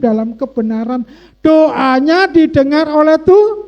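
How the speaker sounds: high, loud, rousing sermon delivery; full-force preaching. Real, not acted. Preacher urging congregation to trust God hears, forceful preaching. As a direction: drive the promise home to the congregation, high and loud.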